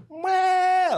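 long, very high loud wail; hammed-up high yell as the coat flies open; loud drawn-out mock shriek; flashing yell.

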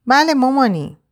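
put-on high child's voice; little boy. A narrator's reading. Boy answering his mother, high child voice. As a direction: answer in a small boy's high pitch. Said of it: "پسر بچهٔ هفت هشت سالهٔ توپلی".

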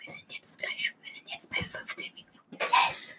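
very soft whisper; ASMR whisper. Shown as a demonstration. Podcaster moving on to the ASMR part, whispering. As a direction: whisper it close, barely audible. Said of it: "jetzt noch A S M R machen".

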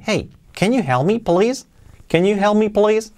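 "Can you help me, please" lifting high on "please"; questioning intonation. Shown as a demonstration. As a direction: raise the pitch on "please" as a request. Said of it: "con entonación también".

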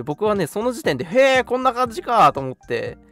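ordinary talk, nothing marked

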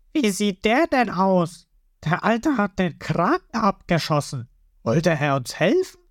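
lightly voiced crewmate. Crew puzzling over the old man, lightly voiced. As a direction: muse about the stranger.

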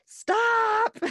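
sudden high shrill yell; yelled stop. Retold or imitated. Mom yelling at squirming baby mid-diaper change. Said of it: "that I yelled".

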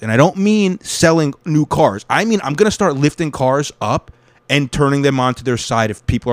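loud, angry tirade at a rude driver; real furious shouting at the guy in the car; furious rant. Real, not acted. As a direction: tear into him, fuming. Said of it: "I'm very mad".

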